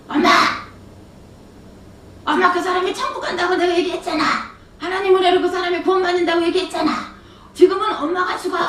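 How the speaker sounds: high, frustrated yelling at mother; angry outburst. Retold or imitated. Daughter snapping at her mother in the car, angry outburst. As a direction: let the anger burst out at her. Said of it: "엄마한테 제가 막 화를 냈어요".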